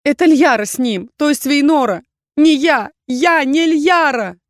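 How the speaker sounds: high, loud cry, stretched at the end; desperate denial. A narrator's reading. Heroine denying she is Liyara, desperate. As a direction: insist frantically on innocence. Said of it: "Безысходность зазвенела в моём голосе".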